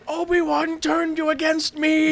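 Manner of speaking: high, slow, whiny; hammed-up jealous Anakin; mock accusation. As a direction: whine the accusation.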